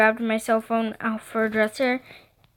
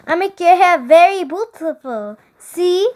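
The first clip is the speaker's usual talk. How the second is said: high, loud fake-French little stylist; phony French accent; 6 semitones higher, 5 dB louder than usual. A narrator's reading. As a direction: show off proudly in bad French accent. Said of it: "that jacked up acc-, accent of hers".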